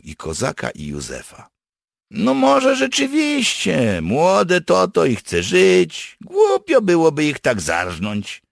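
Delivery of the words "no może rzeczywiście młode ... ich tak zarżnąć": high, loud, drawn-out character voice; reluctant, relenting partisan. Acted. Partisan reconsiders killing the young captives, relenting. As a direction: relent aloud, stretched out and high.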